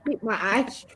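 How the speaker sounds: ordinary talk, nothing marked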